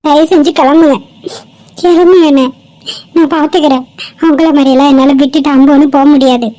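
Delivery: loud, reproachful rebuke; indignant dismissal. Acted. Girl telling heartless bystanders to leave, indignant.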